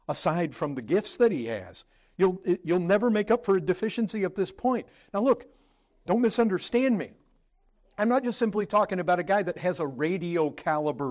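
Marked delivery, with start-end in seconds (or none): 2.2-9.4 raised, driving pulpit voice; full-force preaching. Acted. Pastor preaching to the brethren, raised and driving. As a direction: preach it hard to the congregation.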